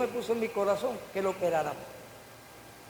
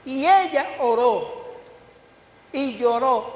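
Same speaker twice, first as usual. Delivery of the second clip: louder and higher, slow and stressed; emphatic preaching; 4 semitones higher, 7 dB louder, slower than usual. Acted. Preacher tells the congregation how Hannah prayed, loud and slow. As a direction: swell up on the climax of the story.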